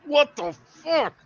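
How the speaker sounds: high, drawn-out mock outrage; retold outrage. Player retells being shot in the leg, high drawn-out outrage. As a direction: stretch it out slow and high in disbelief.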